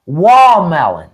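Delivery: WALL MELON slowly, middle of watermelon swallowed; slurred country "wall melon"; Southern watermelon. Shown as a demonstration. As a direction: say "Wall melon" slow, not enunciating the T. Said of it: "totally enunciate all of that".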